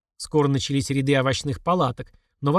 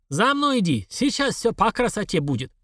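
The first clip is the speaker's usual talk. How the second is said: put-on higher, confident Caucasian-accented vendor; 7 semitones higher than usual. A narrator's reading. Vendor leading the old woman to onions, assured Caucasian accent. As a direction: lead them on with a Caucasian accent. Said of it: "хрипловатый кавказский баритон".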